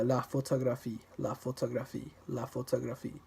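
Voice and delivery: "la photographie" evenly, no syllable standing out; unstressed French syllables. Shown as a demonstration. Drilling French words without stressing any syllable, evenly weighted. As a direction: repeat "la photographie" with equal weight on each syllable. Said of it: "you don't stress any particular syllable".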